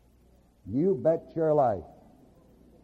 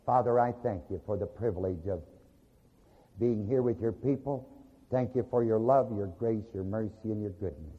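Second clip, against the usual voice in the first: lower, softer prayer voice fading at the ends; closing prayer; 8 semitones lower, 4 dB quieter than usual. Real, not acted. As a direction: pray it humbly, drifting quieter.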